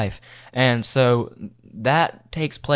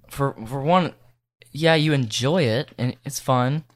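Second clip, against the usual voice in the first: normal talking voice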